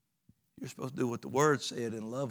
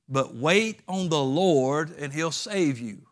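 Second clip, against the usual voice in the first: slow scripture read; 4 dB louder, slower than usual. Acted. Quoting scripture slowly.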